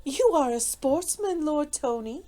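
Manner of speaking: high, playful lady's lilt; merry. A narrator's reading. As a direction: tease a friend gaily. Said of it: "said Marguerite merrily".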